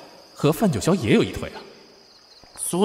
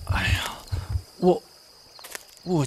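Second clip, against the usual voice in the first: breathy whispered sigh; a hushed nervous hiss; 3 semitones higher, 6 dB quieter, slower than usual. Acted. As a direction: whisper it, cornered and uneasy. Caught out, nervous.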